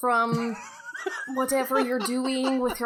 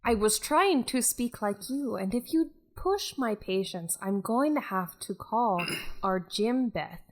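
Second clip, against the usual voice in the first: light Scottish lilt; Scottish accent; 3 semitones lower than usual. Acted. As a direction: keep a mild Scottish accent. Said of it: "this is a very Scottish Beth".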